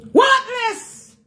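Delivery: high, loud scornful yell; scornful shout. Real, not acted. Ranting at internet critics, a loud scornful shout. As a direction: spit the word out in contempt.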